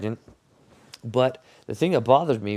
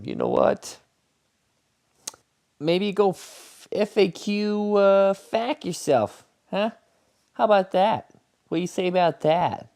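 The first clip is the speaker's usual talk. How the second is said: mocking defiance; 12 semitones higher than usual. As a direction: taunt the king in a squeaky sassy voice. Mocking defiant retort, very high.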